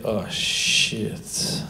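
breathy whispered dread; whispered oh shit. Retold or imitated. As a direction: whisper it as the penny drops. Realising the orderly's fatal joke. (chuchoté, effaré)

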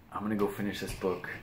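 ordinary talk

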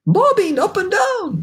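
very high, slow incredulous outcry; cried in disbelief. A narrator's reading. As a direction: echo it as an astonished exclamation. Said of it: "they cried".

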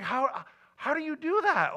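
high, loud incredulous question; can't believe it's possible; amazed disbelief. Retold or imitated. Asking his calm mentor, high and incredulous.

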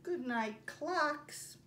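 gentle sing-song storybook reading; reading to children. Acted. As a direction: read it slowly for little listeners. Teacher reads picture book aloud.

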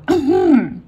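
sharp, high throat clearing. Acted. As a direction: clear your throat loudly for attention. Hears a loud sound.

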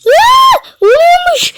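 high, loud yelp breaking into a laugh; surprised shout. Real, not acted. Gamer yelling in surprise as the trick works, laughing. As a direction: shriek with delight as it suddenly works.

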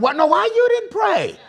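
high, loud exasperated demand; acted indignant outburst; frustrated shout. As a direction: shout down the excuse, fed up. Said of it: "I don't want God frustrated with me".

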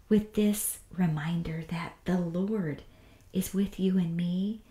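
ordinary delivery

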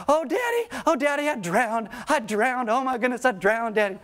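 high-pitched mock wailing of a little girl; imitated sobbing child. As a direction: play a panicked, sobbing daughter crying to her dad. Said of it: "she is just sobbing".

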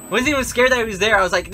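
normal talking voice

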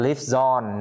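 "live zone" run together, a z sliding into "on"; linked sounds. Shown as a demonstration. Teacher models linking for students, "live zone" slowly joined up. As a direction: slowly join the z onto "on", saying "live zone". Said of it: "nối sang live zone".

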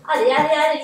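loud urging. Real, not acted. Urging on the final sprint.

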